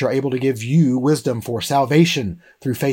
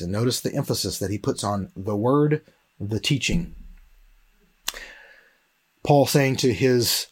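ordinary talk

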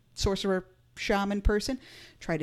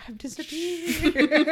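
put-on high, drawn-out playful kid voice; mock little-kid voice, high and teasing; imitating a kid; 9 semitones higher, much slower than usual. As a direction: stretch it out slowly like a vanishing child. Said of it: "they're just like".

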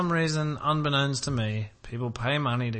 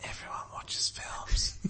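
whispered aside; 7 dB quieter, slower than usual. Real, not acted. Muttered aside about films.

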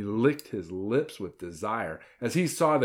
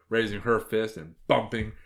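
plain reading voice

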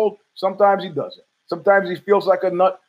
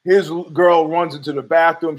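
ordinary delivery

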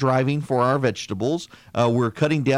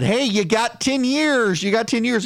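raised, stretched-out pretend scream; mock screaming voice; 8 semitones higher than usual. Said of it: "that little voice is now screaming that".

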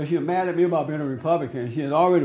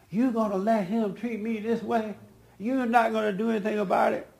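higher-pitched imitation of an affronted wife; demanding wife; 7 semitones higher than usual. Wife to her husband about his cousin, high demanding voice. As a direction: put on a high, goading complaint.

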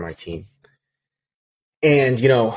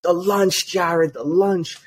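much higher, slow mock yell; mock scream; 9 semitones higher, much slower than usual. Office manager yelling for lunch, hammed-up high mock yell. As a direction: wail it like a frantic office manager. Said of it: "the whole thing was just her like".

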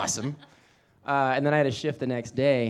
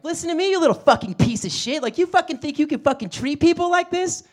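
much higher, louder, raging insult; furious mom; 9 dB louder than usual. Retold or imitated. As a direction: scream it like an enraged suburban mom. Angry mom storms in.

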